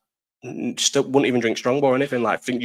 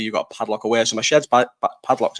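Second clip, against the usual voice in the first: usual speaking voice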